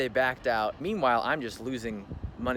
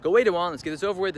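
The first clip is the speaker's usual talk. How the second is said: higher, louder re-enacted dismissal; retold calling out; 6 semitones higher, 6 dB louder, faster than usual. Telling man banging on door to leave, retold calling out. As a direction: call it through a shut door.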